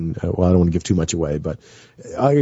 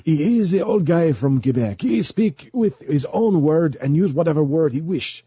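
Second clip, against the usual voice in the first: put-on old Québécois man, pitched up and drawn out; older Quebec French accent; 7 semitones higher than usual. Shown as a demonstration. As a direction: age up the Quebec French accent, higher and slower. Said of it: "I have to make it older than that".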